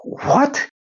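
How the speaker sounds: high startled yelp of disbelief, then indignant; a guy told he's pregnant, squeaking in outrage; shocked disbelief. Retold or imitated. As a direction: recoil at the news and refuse it outright. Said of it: "And the guy would go".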